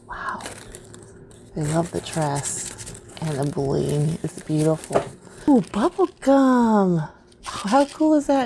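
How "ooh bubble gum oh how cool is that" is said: high, delighted ooh; squeal-edged delight, pitched well up; really excited. Real, not acted. Crafter finding bubble gum on a friend's card, excited. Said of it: "Sorry, I'm really excited".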